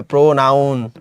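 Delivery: প্রোনাউন drawn out as pro-NOUN; common mistake demo. Shown as a demonstration. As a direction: slowly sound out the "noun" in প্রোনাউন. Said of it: "অনেকে কিন্তু confidently ভুল করি".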